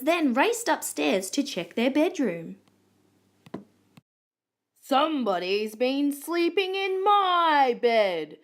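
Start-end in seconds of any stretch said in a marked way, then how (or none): 4.8-8.4 slow, big indignant grumble swelling on "my"; grumbling daddy koala. A narrator's reading. Daddy koala finding his bed slept in, grumbling. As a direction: draw it out crossly. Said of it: "grumbled Daddy Koala".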